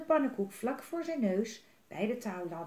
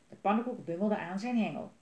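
ordinary delivery, nothing marked

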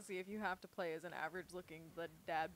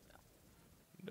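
menacing movie villain; 16 dB quieter than usual. Retold or imitated. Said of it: "your impression of, uh, acting... the bad guy from No Country for Old Men".

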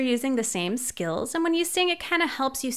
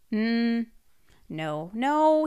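normal talking voice

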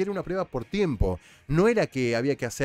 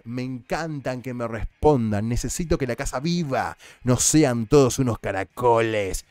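Furia; 5 dB louder than usual. Retold or imitated. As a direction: imitate a housemate relishing the fight. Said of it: "yo te lo digo más o menos igual como habla ella".

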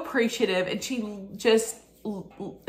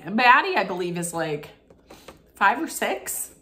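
normal talking voice

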